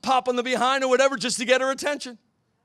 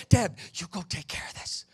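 hushed whisper to his wife; whispered plea; 3 semitones lower, 4 dB quieter than usual. Retold or imitated. Husband whispering to wife from the kitchen, hushed plea. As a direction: whisper it, begging her to handle it. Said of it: "I'm like".

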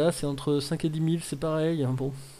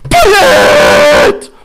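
very high, full-force yell; real ecstatic scream; 20 dB louder than usual. Real, not acted. Streamer finally pulling the wanted card, ecstatic scream. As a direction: scream the name out, stretched.

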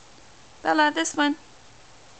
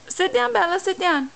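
sharp, high-pitched parent command; firm command; 8 semitones higher than usual. Real, not acted. Mother repeating order to toddler.

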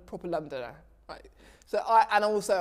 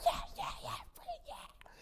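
whispered glee; 10 dB quieter than usual. Retold or imitated. Excited after the phone call.

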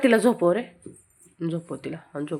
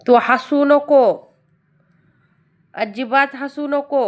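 mock stern scold; 5 semitones higher, 6 dB louder, slower than usual. Real, not acted. Scolding a giggling child.

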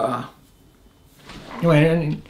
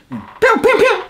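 high, loud mouth gunfire pew pew; playful imitated sniper fire; gunshot sounds; 3 dB louder than usual. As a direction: imitate the shots popping overhead.

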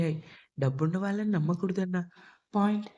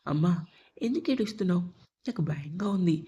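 plain reading voice

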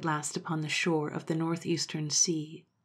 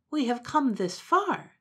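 higher, slow pleading monkey voice; 7 semitones higher, 4 dB louder, slower than usual. Acted. Monkey begging Momotaro, high and slow. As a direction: plead in a small raised voice.

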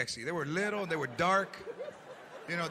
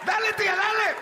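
high shouted cheer; hollering dale at tia; cheering her on; 9 semitones higher, 4 dB louder than usual. Retold or imitated. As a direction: egg the dancing aunt on. Cheering aunt on.

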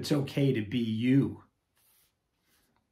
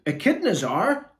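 louder, higher quick blurt; 10 semitones higher, 9 dB louder than usual. Acted. Petunia blurting to the punk rocker, sudden blurt. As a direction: burst it out before thinking. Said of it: "blurted Petunia".

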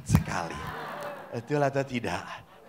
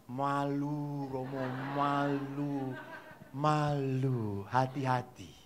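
low, soft drawn-out mumble; bashful child; 6 semitones lower, 10 dB quieter, much slower than usual. Retold or imitated. Child admits embarrassment to priest, soft bashful drawl. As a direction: shrink shyly from the order.

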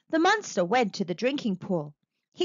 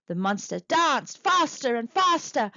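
ordinary delivery

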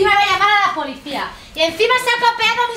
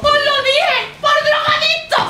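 shrill accusation; 7 semitones higher, slower than usual. Acted. Landlady shrieking accusation.

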